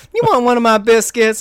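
high, loud mock commercial lady; hammed-up fast food ad lady; Popeyes lady. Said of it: "the lady from the Popeyes commercials".

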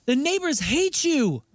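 high, drawn-out mock scolding; scolding the dog. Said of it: "I always walk outside. I'm like".

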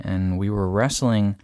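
ordinary talk

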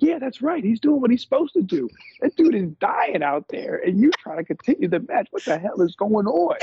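high, outraged mock Booker; hammed-up high Booker rant; imitating Booker. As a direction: play Booker's disbelief at the match going on. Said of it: "Booker was like".

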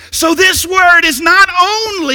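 high, loud burst of excitement; excited preaching. Real, not acted. Preacher back from vacation tells congregation about the Word, excited. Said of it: "excuse me if I get excited".

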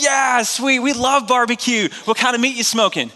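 high, eager mock enthusiasm; excited. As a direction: play the thrilled visitors. Missions team thrilled about barbecue.

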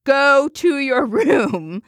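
high, drawn-out mock bellow; hammed-up, slow, high-pitched parental yell; retold explosion. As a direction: blow up at the kid, each word stretched.

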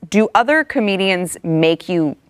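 normal talking voice

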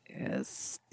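soft whispered aside. Real, not acted. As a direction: whisper it conspiratorially.

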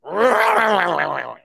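falling mock dinosaur rawr; a lazy playful growl. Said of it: "Rawr of the week".

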